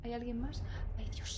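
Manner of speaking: tense whisper while sneaking; scared hushed whisper. Real, not acted. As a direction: breathe the words so no one hears. Sneaking past enemies, whispering.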